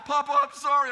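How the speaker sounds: put-on high, loud pleading kid voice; imitating an upset grandson; apologetic child.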